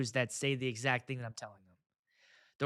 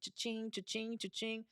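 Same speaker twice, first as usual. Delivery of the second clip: gleeful cha-ching; 5 semitones higher, 11 dB quieter than usual. Pretend. Counting money on Venmo.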